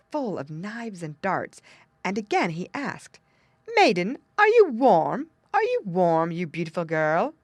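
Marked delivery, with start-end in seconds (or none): 3.7-7.3 King Frost pressing. A narrator's reading. King Frost bending over the girl, loudly pressing. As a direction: press her with a loud, lightly voiced king.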